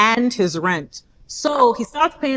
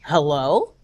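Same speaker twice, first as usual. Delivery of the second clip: low, questioning hello; puzzled reply on phone; 8 semitones lower than usual. Retold or imitated. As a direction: answer lower, as if thrown. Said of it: "I go".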